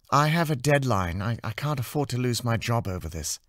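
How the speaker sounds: firm but worn; tired artist. Acted. Anna defending her deadline to Amy, tired and firm.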